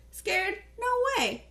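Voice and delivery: high indignant denial; protesting. Acted. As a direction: deny it hard, way up high. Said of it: "Tino protested".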